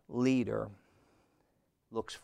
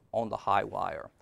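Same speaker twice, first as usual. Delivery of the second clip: ordinary delivery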